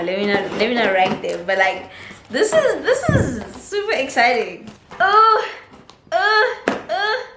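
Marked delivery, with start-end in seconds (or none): 5.0-7.3 short high excited "oh"s; three high yelps of excitement, easing in volume; little excited high-pitched oohs. Real, not acted. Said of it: "I love getting excited".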